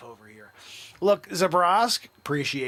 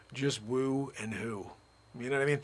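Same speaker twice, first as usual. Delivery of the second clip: usual speaking voice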